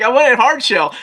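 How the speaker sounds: high-pitched imitation of a kid's protest; complaining daughter. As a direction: play a disappointed little complainer. Acting out his complaining daughter.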